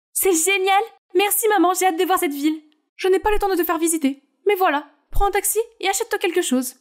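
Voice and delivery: thrilled to see the city; excited teen. Acted.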